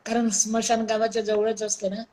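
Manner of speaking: usual speaking voice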